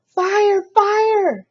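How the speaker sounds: high, loud shout of fire; alarm cry. Acted. Cat shouts a false alarm at the birds, high and loud. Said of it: "he cried".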